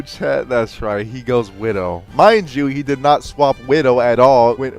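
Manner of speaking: ordinary delivery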